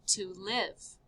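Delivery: normal talking voice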